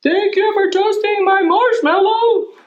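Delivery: high sweet cartoon voice; squeaky little figure saying thanks; cute character. A drawn character thanking the dragon, high cartoon voice.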